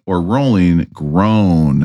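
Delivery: very slow, falling in pitch; drawn-out groan. Acted. Host offering a co-host the last rating, drawn-out groan. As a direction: stretch it out into a sinking groan.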